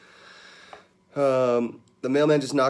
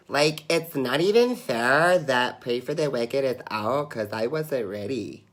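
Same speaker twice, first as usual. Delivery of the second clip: put-on slow Valley Girl accent; drawn-out Valley Girl impression; 3 semitones higher, slower than usual. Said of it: "do a Valley Girl impression".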